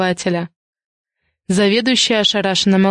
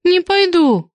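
high, squeaky refusal; petulant, high-pitched talking back; defiant retort; 10 semitones higher, slower than usual. A narrator's reading. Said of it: "огрызнулась Киска".

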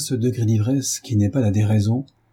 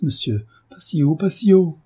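plain reading voice

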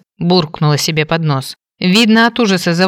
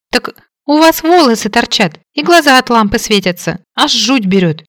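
high frightened squeak; squeaking maid; 6 semitones higher, 3 dB louder than usual. A narrator's reading. Maid scared of the mistress's hair, squeaking high. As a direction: squeak it out, backing away. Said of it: "пропищала служанка".

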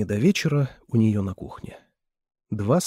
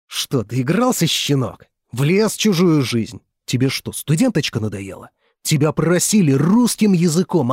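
loud, higher angry tirade; angry scolding; 4 semitones higher, 6 dB louder than usual. Acted. Older brother berating his younger rival, loud and furious. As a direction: tear into him, rising to yells.

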